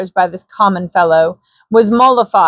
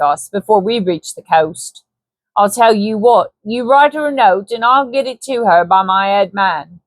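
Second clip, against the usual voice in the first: light Cockney trader voice; Cockney Hanson. Acted. Hanson flatters Baines, light Cockney persuasion.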